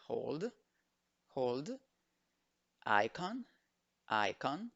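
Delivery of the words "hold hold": "Hold" with the closing d sounded; final D pronounced. Shown as a demonstration. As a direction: land clearly on the d of "Hold". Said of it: "La D al final también se pronuncia". ("Hold" pronunciando la d final)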